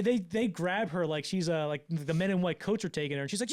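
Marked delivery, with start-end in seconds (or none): none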